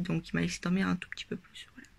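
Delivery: soft unvoiced whisper. Real, not acted. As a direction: whisper it as an aside. Aside about sleeping in.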